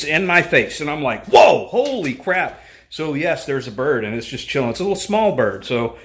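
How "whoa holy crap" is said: loud, higher, drawn-out startled cry; startled outburst. Retold or imitated. Bird just screamed in his face, retold startled outburst. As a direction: react as if jumped at, slowly and loudly.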